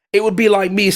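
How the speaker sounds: normal talking voice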